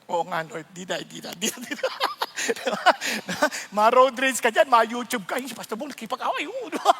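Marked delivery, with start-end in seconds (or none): 0.0-1.9 softer, lower meek promise; sheepish reply. Pretend. A chastened driver answering the Lord in a meek, soft voice. 5.4-6.5 fast, softer gossip line; mock headline. Fast mock headline about the pastor fighting on the road.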